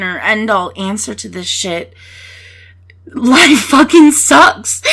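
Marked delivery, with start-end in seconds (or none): 3.1-4.8 loud, slow and higher, each word hit; emphatic outburst. Real, not acted. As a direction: blurt it out with force. Emphatic pep talk outburst.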